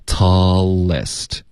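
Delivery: "tallest" drawn out, L closing tall and starting lest; slow unbroken glide through the L; connected L. Shown as a demonstration. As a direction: link "tallest" in one breath. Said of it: "tall结尾就有一个L的音出现了".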